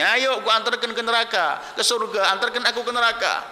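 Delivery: high-pitched imitated jeer; mocking taunt. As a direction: taunt the enemy like a cocky warrior. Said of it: "ini si keliling mengejek ini". (nada mengejek dan menantang)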